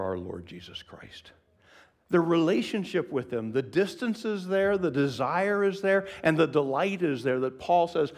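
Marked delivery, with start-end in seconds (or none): 2.1-8.1 loud, high preaching build; full-voiced sermon delivery. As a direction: preach it with rising fervour.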